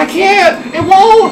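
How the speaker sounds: panicked. Real, not acted. Panic in game.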